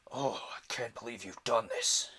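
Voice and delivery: forced, strained character voice; Invisible Man. Shown as a demonstration. Reader demonstrating a taxing character voice, forceful and strained. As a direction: put extra force into a put-on villainous voice. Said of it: "the Invisible Man's voice".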